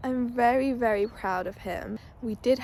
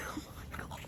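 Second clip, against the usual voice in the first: whisper; 14 dB quieter than usual. Real, not acted. Reacting to the cut mane.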